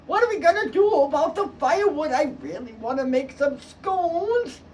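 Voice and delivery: a hint of a fretting mom; mother's plea. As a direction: whine about the scones. Mother wants firewood.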